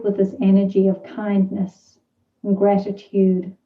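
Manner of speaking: measured meditation voice with long, spacious pauses; guided meditation. Real, not acted. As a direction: let each phrase settle before the next.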